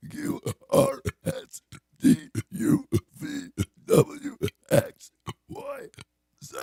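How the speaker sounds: burped alphabet, letter by letter; burping the words. Real, not acted. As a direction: burp out each letter. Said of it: "All right, prove it".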